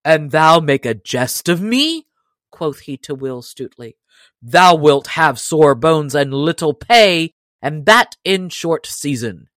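a touch of angry menace; growing angry. Acted. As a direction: warn him he will get hurt. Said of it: "the stranger began to grow angry".

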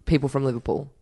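ordinary talk

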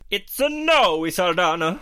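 high, slow, loud playful line; sing-song. Acted. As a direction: stretch it out teasingly. Playful rating sign-off.

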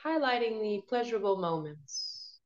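guided meditation. Acted. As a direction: ease them gently into calm.

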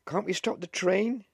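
very high, loud plea; panicked shout. A narrator's reading. Wife pleading as the train pulls out, shouted in panic. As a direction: cry out in panic. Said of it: "she shouted in panic".

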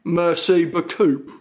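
slow, posh English-accented French. Shown as a demonstration. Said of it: "say it with a posh English accent".

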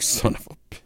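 whispered cut-off curse; under-breath curse. Retold or imitated. Hearing the show is on Max, whispered curse. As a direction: hiss it under your breath. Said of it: "I'm like".

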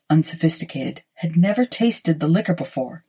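ordinary delivery, nothing marked

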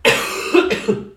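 loud hacking cough; a real coughing fit; choking cough. Real, not acted. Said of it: "pardon, ça va aller mieux".

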